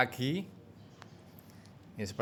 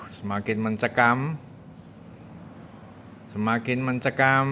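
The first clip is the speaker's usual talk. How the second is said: play-by-play. Real, not acted. Live race commentary.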